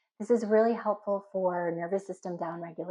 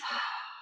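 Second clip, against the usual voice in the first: soft audible sigh; relieved out-breath; demonstrated exhale; 8 dB quieter than usual. Shown as a demonstration. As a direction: breathe out and release. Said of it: "exhale, release".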